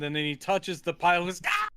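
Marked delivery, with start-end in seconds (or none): 1.5-1.7 short mock burn yelp; pained yelp. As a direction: yelp as if touching something hot. Acts out Dracula getting burned.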